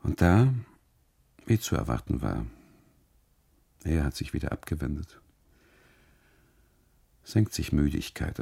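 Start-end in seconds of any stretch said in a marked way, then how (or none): none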